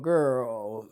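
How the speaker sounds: ordinary talk, nothing marked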